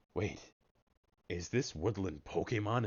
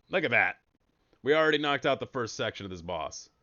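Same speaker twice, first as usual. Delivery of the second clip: usual speaking voice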